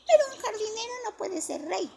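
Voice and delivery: put-on high child voice objecting; a kid insisting he's wrong. A narrator's reading. A child tells Johnny a gardener can't be king, high child voice.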